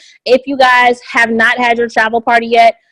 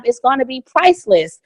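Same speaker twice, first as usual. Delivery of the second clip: ordinary talk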